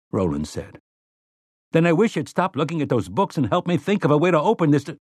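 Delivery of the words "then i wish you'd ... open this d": fast, high annoyed rush; irritated. Acted.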